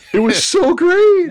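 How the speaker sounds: high, slow, loud delighted gush; excited child. Retold or imitated. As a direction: gush like a thrilled kid. Child's delight retold by dad.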